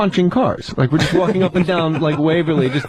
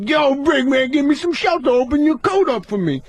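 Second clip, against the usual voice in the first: Tracy impression; 7 semitones higher than usual. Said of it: "He's like".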